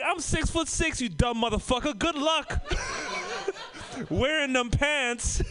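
high, laughing taunt; mock scolding. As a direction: scoff at the thieves. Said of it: "And I'm like".

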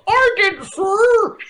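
very high, put-on reply; high character voice. Retelling the reply to the angry doctor, high put-on voice.

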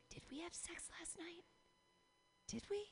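high, tiny whisper to yourself; hushed morning-after question. Acted. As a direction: whisper it, unsure and squeaky. Voicing herself waking up unsure.

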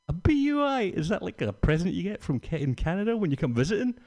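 high, bright, fast naive asking; a drunk feigning innocence to a Mountie, high and quick; mock innocent question. Mock-innocent reply to cop.

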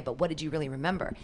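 normal talking voice

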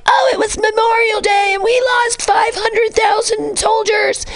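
over-the-top mock American lament; mocking whiny Americans. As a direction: shout it like a self-pitying American, hammed-up. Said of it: "Because we're like".

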